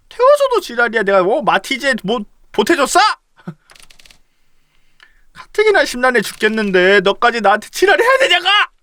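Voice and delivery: high, loud furious outburst at a friend; angry yelling. Acted. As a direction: snap and yell, fed up. Acted quarrel continues. (화나서 높고 크게 소리치기)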